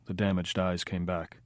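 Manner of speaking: plain reading voice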